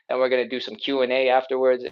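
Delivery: ordinary talk, nothing marked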